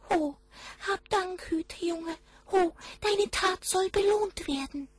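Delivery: very high, thin goblin voice calling out; high kobold voice. A narrator's reading. Goblin thanking the shepherd boy, very high voice. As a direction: squeak it like a tiny goblin. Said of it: "sagte der Kobold".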